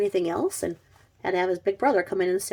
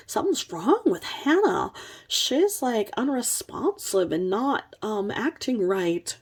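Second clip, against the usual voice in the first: higher, put-on anxious phone voice; mock worried babysitter; 4 semitones higher than usual. Babysitter calling dad on phone, mock worried. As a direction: feign concern, slowing at the end. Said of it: "calls single father Jason and says".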